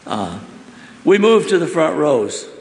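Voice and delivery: ordinary delivery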